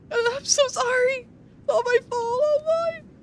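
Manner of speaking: high, crying voice; tearful apology. Acted. Marn apologises to Wiki, high and tearful. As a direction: blame yourself through tears.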